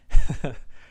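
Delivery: short breathy laugh that breaks the telling; sudden real laugh; burst of laughter. Real, not acted. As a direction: laugh out loud, caught off guard. Laughs mid-retelling.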